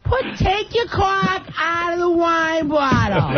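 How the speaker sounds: put-on Massachusetts accent. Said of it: "How do you say C A U L K in Massachusetts".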